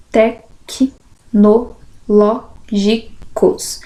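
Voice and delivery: "tecnológicos" very slow, with a pause after each syllable. Shown as a demonstration. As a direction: dictate "tecnológicos" in separate syllables. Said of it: "Separação de sílabas".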